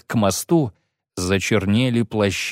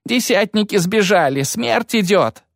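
moaned; 10 semitones higher than usual. A narrator's reading. Soldier despairs as the leaders flee, wailing high. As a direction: wail that all is lost. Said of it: "простонал другой".

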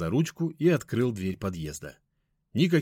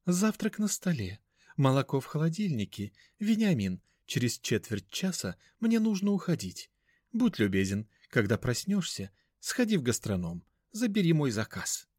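high mannered voice; 6 semitones higher than usual. Acted. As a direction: call out in a lofty mannered tone. Mother gives instructions.

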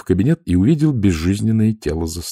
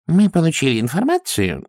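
timid excuse; 8 semitones higher than usual. A narrator's reading. Boggis starts excuse.

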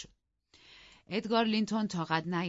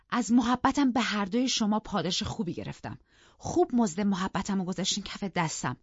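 higher, louder indignant scolding; heated, raised-pitch outburst; angry defiance; 4 semitones higher, 5 dB louder than usual. Acted. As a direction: defy your husband and rebuke him bitterly. Wife defies and berates both men.

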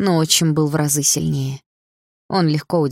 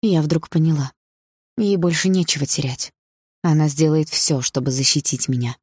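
usual speaking voice

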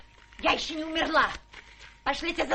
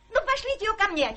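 ordinary talk, nothing marked

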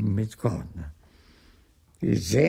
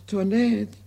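raised, higher call for the maid; 10 semitones higher than usual. Acted. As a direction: call Toinette across the house. Argan calling his maid.

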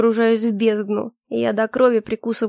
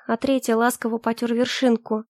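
ordinary delivery, nothing marked

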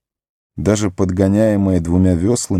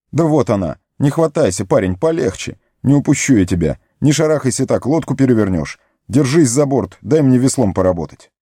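higher, raised orders; urgent commands; 6 semitones higher than usual. Acted. Frodo pulling Sam to the boat, higher urgent orders. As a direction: take charge, fast and firm.

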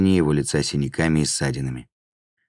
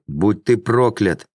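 slow, higher called-out curse; mild cried curse; 5 semitones higher, slower than usual. A narrator's reading. Beaten man to departing demon, a restrained cry. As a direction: call out a curse, drawn out but restrained. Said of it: "крикнул он".

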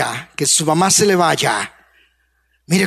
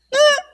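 put-on bored child "ah". Imita niño indiferente.

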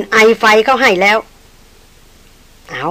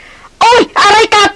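very high, loud yelp of pain; pained cry; 13 semitones higher, 4 dB louder, much slower than usual. Acted. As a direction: cry out as something bites. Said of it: "เขาร้องออกมาสุดเสียง". (ร้องสุดเสียงด้วยความเจ็บ)

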